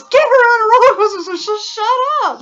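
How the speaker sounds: imitating fed-up husband; husband yelling to get her on a ride; mock yell. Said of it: "He goes".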